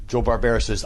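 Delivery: usual speaking voice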